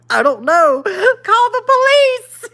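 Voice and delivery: high laughing answer. Real, not acted. Answering a chat question while laughing hard. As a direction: laugh it out, caught off guard. Laughing at a home invasion scenario.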